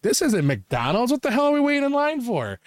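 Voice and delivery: hammed-up bafflement climbing high; mock indignant outburst. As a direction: complain for the whole queue to hear. Acted public outburst in line.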